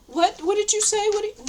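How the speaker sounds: squeaky hammed-up mock of someone not hearing; mock confused listener. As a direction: ham up a puzzled person straining to hear. Mocking someone who can't hear.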